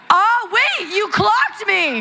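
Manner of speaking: high, drawn-out astonishment; caught out. Real, not acted. Guest reacts to being corrected by audience, high-pitched surprise. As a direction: exclaim it high, playfully scandalised.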